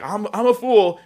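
hammed-up high "I'm a fool"; mock Agur. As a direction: squeal it like a man calling himself a fool. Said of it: "how Agur goes like".

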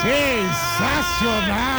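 very high, drawn-out gleeful yell; excited shout. Real, not acted. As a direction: shout the prank reveal with joy. Prank reveal, hosts shout.

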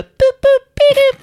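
very high, loud cartoon boop; playful boop. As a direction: squeal it like Betty Boop. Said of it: "That's my Betty Boop impression".

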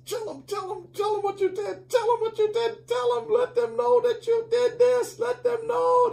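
the flesh shrieking for credit; preacher voicing the flesh demanding recognition; clamoring flesh. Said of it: "I know I feel that".

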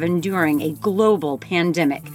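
slow, dramatic trailer voice; movie trailer narration. Acted. Introducing the sisters' show, slow dramatic trailer voice. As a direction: read it like an epic movie trailer.

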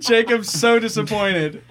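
words through laughter; laughing. Real, not acted. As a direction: laugh while you say it. Table cracking up at joke.

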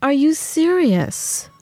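high, drawn-out disbelief; incredulous. Retold or imitated. Patient retelling her reaction to a paid meet-and-greet, incredulous. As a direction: stretch the question out in indignant disbelief.

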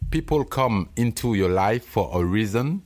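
English said with a heavy French accent. Shown as a demonstration. Host reading an English proverb, in French-accented English. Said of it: "la puissance de mon accent".

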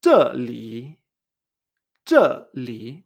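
"zhe li" with a falling zhe and a low curved li; falling then dipping. Shown as a demonstration. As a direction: model zhe li, drop then dip. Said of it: "que baja y hace este tono curvo".

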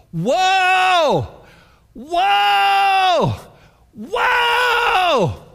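high, loud drawn-out woe; angel's cry. Acted. Angel crying woe over the whole earth, loud and high. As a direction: wail it like a flying angel.

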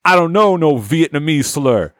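mock-indignant tough guy; blaxploitation hero. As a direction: protest it in a jive movie voice. Fro answers back.

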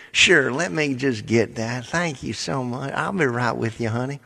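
put-on help the caller sweetly in a Southern accent; friendly Southern agent. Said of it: "they're just the friendliest things".

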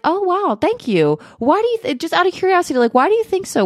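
high, bright, eager questioning; curious delight. Pretend. To a colleague who praised you, high eager curiosity. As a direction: sound genuinely pleased and curious. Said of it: "you can ask them, like".

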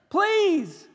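high, loud shouted plea; scream. Acted. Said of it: "get up here and scream".

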